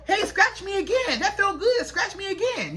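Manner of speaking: high-pitched, eager pet voice; begging dog. Acted. Dog asking its owner to scratch again, pleading. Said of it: "that dog start getting up under you".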